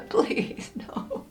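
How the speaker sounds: whispered, laughing please no; breathy laughing plea. Real, not acted. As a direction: beg softly through a laugh. Dreading cinnamon, laughing quietly.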